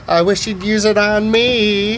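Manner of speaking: put-on high, slurry slow drunk giggle; half drunk. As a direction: drag it out high, tipsy and giggling. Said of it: "kinda half drunk".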